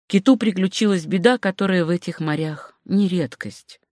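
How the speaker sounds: ordinary delivery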